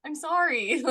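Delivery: high-pitched retold apology; mock apology. Mother's guilty reply to cold child.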